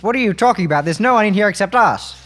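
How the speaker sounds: ordinary talk, nothing marked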